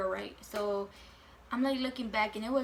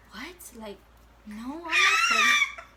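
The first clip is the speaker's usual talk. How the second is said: shocked squeal; 7 semitones higher, 10 dB louder than usual. Retold or imitated. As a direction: squeal it, much higher and louder. Shocked denial to mom's claim.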